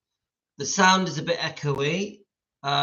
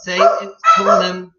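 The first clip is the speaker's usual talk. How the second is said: loud call; 3 semitones higher, 10 dB louder, slower than usual. Real, not acted. Calling the child over.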